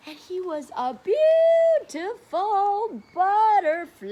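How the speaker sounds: high and loud in wonder; awed reveal. Acted. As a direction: unveil the butterfly with delight. Revealing butterfly to children.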